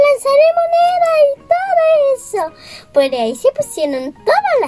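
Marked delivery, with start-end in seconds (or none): 0.1-2.9 excited cheer. Acted. Crowd cheering puppet show.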